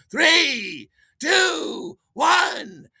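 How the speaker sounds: high, loud crowd cheer; party crowd at full shout; shouted New Year countdown. Retold or imitated. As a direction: yell the countdown, stretch the final cheer.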